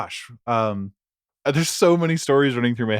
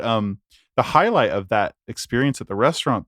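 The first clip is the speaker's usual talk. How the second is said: ordinary talk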